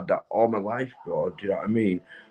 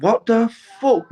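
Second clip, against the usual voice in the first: higher, louder, slower what the fuck; jolted by what a viewer just said; shocked outburst; 12 semitones higher, 5 dB louder than usual. Real, not acted. Streamer reacting to a viewer's comment, higher and louder in shock. Caught off guard by chat.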